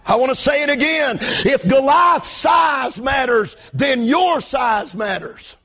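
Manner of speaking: high pulpit voice, slow, heavy emphasis; full-force preaching. Real, not acted. Pastor repeating his point on Goliath's size, forceful preaching.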